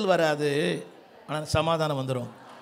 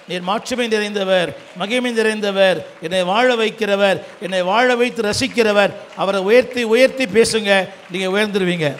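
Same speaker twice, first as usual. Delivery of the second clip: preaching at full force; 6 dB louder than usual. Real, not acted. Preacher exalting God before the congregation, building loud praise. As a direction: proclaim each title louder, holding the words.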